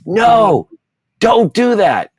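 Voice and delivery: sudden high, loud scream of alarm; mother's scream. Retold or imitated. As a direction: scream it to stop a small child's hand. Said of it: "she screamed at me".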